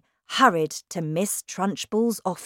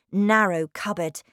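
ordinary delivery

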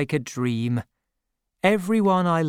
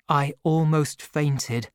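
usual speaking voice

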